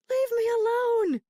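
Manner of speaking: high, loud panicked scream. Acted. Sick mother screaming at the nurses taking her, high and loud. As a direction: scream it at the nurses to get away. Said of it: "she screamed".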